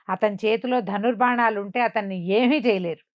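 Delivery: ordinary delivery, nothing marked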